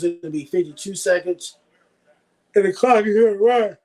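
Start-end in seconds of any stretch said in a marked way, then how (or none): 2.6-3.8 talking through a yawn. Real, not acted. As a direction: say it mid-yawn, mouth stretched. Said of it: "Sorry for the yawn".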